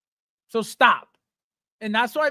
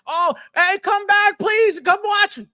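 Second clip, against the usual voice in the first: high, loud pretend begging; put-on desperate plea, raised pitch; mock pleading; 7 semitones higher, 4 dB louder, slower than usual. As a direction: beg them back, hammed-up and insincere. Mock begging departed viewers to return.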